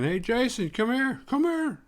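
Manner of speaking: hammed-up taunting call. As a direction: bait him from afar, rising. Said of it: "Taunting him".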